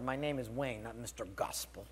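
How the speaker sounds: low, softened retold grumble; muttered retort.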